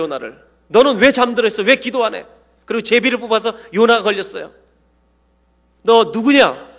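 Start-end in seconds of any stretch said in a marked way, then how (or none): 0.7-2.3 higher, louder rebuke; scolding shout. Retold or imitated. Sailors shake sleeping Jonah awake, high scolding shout. 5.8-6.7 higher, louder interrogation; sharp loud demand. Retold or imitated. Sailors grill Jonah, loud and high.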